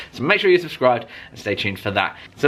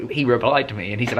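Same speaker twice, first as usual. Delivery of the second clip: ordinary delivery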